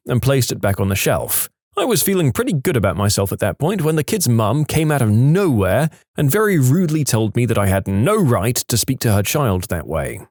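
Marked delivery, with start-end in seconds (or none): none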